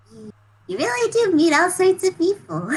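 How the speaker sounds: high-pitched marveling; amazed character. Acted. Bevan marveling at someone new, amazed. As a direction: marvel aloud, pitched well up.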